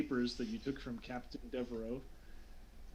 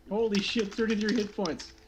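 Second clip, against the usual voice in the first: excited; 7 semitones higher, 7 dB louder than usual. Real, not acted. GM reacts to huge roll.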